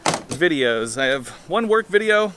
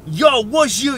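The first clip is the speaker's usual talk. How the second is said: gruff Boston guy; 7 semitones higher, 3 dB louder than usual. Retold or imitated. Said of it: "kinda gruff on the outside and just like".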